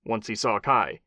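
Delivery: ordinary delivery, nothing marked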